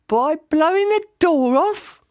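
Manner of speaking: put-on high child's voice, sarcastic; boy scoffs at the lie. A narrator's reading. Son mocks dad's excuse, high and sarcastic.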